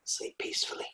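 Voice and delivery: very soft, breath-only whisper; acted bedtime hush; hushed goodnight. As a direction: whisper it to someone drifting off. Acting whispering to sleeping partner.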